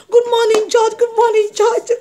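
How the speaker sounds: high, loud insistent greeting; pestering widow. Retold or imitated. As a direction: call out to the judge, high and insistent. Widow pestering judge, acted out.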